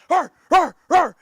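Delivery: loud gruff bark; barking dog. Pretend. Speaker imitates foaming dogs outside, loud barking. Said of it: "barking like".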